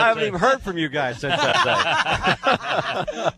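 high laughing mock complaint, slow. Real, not acted. Guest teases hosts who never called, laughing.

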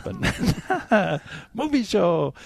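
high, slow, squeaky minion babble. Retold or imitated. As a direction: stretch it out like a little yellow minion. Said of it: "They speak, uh, fake Italian?".

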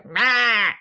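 put-on squawked bird calls in an Aussie accent. Birds in Australia calling out to each other, squawky Aussie accent. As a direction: bird screeching g'day in broad Australian. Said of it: "doing things with an Aussie accent".

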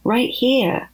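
slow, patient explaining; patiently. Acted. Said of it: "said Opie patiently".

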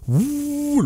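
high, soft "woo" of blowing air; imitated whoosh. Host describing an air conditioner's exhaust tube, high whooshing noise. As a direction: mimic air rushing up a tube.

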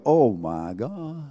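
slow, soft "Oh, my gosh", stunned; stunned relief. Acted. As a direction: let out the servant's stunned relief. Voices the relieved servant.

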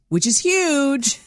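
excited. Real, not acted. Praising guest's wins.

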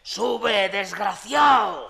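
angry yell down the stairwell; angry shout. Acted. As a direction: yell at him to come up. Yelling down the stairs.

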